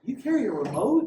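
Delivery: high, loud disbelieving question; incredulous kid. Retold or imitated. Kids questioning the officer's remote, loud incredulous squeal. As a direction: imitate a kid who can't believe it. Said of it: "And then they go".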